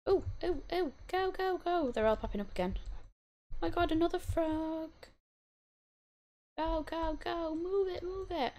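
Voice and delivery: excited. Real, not acted. Frantic frog chase.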